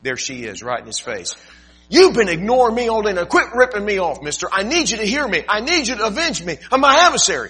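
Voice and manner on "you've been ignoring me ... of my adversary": high, loud nagging plea; insistent widow. Acted. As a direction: get right in his face and demand. Widow confronts judge in face.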